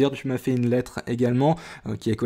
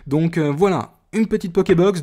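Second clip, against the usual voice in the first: excited; 5 semitones higher, 5 dB louder than usual. Real, not acted. Presenting the box eagerly.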